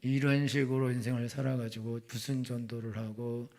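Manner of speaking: ordinary delivery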